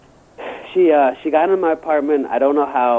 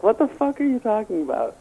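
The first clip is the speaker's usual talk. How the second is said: lightly voiced indignant prom date, pitched up; offended girl; 6 semitones higher, 4 dB quieter than usual. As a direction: mimic her baffled offence, higher. Imitates offended prom date's reply.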